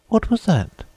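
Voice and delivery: put-on very high girlish question; Alice asking. A narrator's reading. Alice asking the Mock Turtle, very high and curious. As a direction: pitch far up, curious young girl. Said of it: "inquired Alice".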